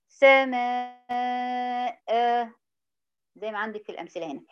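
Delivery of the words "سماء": سماء held out very long on a level tone; stop with long alif. Shown as a demonstration. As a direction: stretch the final vowel of سماء as a natural madd. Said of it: "يبقى هقف عليها إيه؟".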